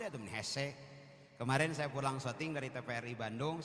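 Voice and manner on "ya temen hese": ordinary delivery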